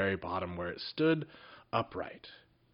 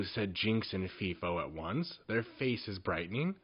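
usual speaking voice

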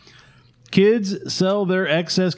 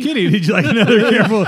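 laughing; 6 semitones higher, 8 dB louder, faster than usual. Real, not acted. Laughing while handing out prizes.

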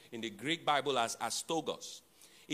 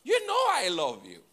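raised, louder protest; angry retort; 7 semitones higher, 6 dB louder than usual. Retold or imitated. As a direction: snap back hurt and frustrated. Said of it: "Peter got angry at Jesus and said".

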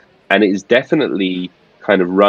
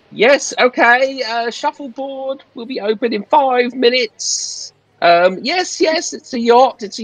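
steward voice. Acted.

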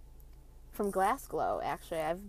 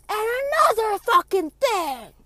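drawn-out, high shriek like a wild banshee; banshee scream; 13 semitones higher, 11 dB louder, much slower than usual. Retold or imitated. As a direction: imitate mom screaming from downstairs. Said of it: "my fucking mother screaming from downstairs".

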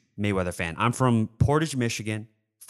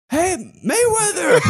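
high, slow, laughing nerdy squeak; nerdy Don Knotts squeak; 7 dB louder than usual. Retold or imitated. Fan squeaking at his hero through laughter. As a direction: squeak it out like a starstruck nerd. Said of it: "The Don Knotts came out in you".

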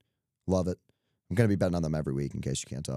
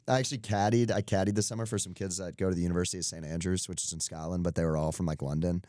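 ordinary talk

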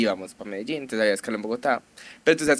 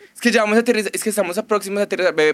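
fast, higher parody of the pilot; mock pilot announcement; 7 semitones higher, 4 dB louder, faster than usual. Mocking the pilot's early landing notice, fast high parody. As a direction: rattle it off like a cabin announcement, mocking. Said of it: "cuando dice el man".

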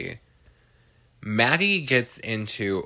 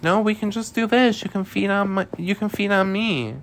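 high-pitched, insistent mock plea; eager pleading; 11 semitones higher, 4 dB louder than usual. Recapper voices Leah offering herself to be fed on, high mock pleading. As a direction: plead in a squeaky imitation, well above your usual pitch.

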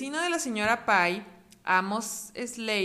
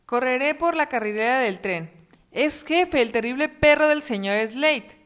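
fleeing fox; 4 semitones higher, 8 dB louder than usual. Acted. Zorro huye.

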